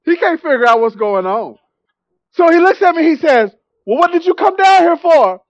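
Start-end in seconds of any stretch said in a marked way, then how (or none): 3.9-5.4 higher-pitched imitation of bafflement; mock perplexed. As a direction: ask it as a baffled preacher would. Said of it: "he's perplexed".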